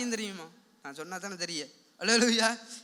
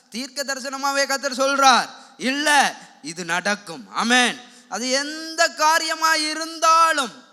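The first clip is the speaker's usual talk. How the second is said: loud, emphatic prophetic declaration; full-force preaching; 4 semitones higher, 10 dB louder, slower than usual. Real, not acted. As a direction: proclaim it loudly, stretching the key words. (உரத்த குரலில் பிரசங்கம்)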